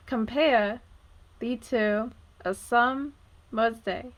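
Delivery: "Compare / thee to / a sum / mer's day", slow, with gaps between feet; one iamb at a time. Shown as a demonstration. Teacher listing the iambs, "Compare thee to a summer's day" slowly foot by foot. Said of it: "is an iamb".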